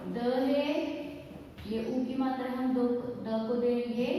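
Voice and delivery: ordinary delivery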